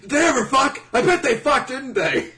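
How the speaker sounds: very high, loud accusing yell; hammed-up obsessed fan, laughing at the end; mock frantic interrogation. Crazed shipper grilling the author, mock frantic shout.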